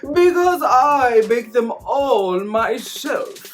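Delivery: slow, high, prim put-on voice; drawn-out superior matron, looking down; haughty mother-in-law.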